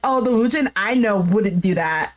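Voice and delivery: ordinary talk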